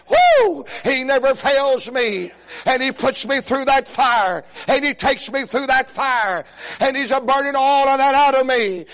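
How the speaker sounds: impassioned, driving preacher cadence; full-force preaching. Real, not acted. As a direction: preach it hard, punching each promise.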